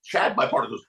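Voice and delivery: ordinary talk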